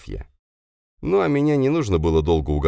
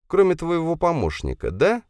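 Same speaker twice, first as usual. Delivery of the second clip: high, mocking female voice; smirking; 13 semitones higher than usual. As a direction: needle him with a smirk. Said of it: "усмехнулась Софья". (Софья насмешливо поддевает инженера)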